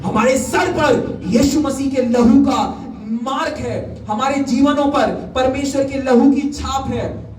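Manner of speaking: high, fervent proclamation; preaching. Real, not acted. Preacher declares God's mark on believers, high proclamation. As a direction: declare it from the pulpit, pitched high.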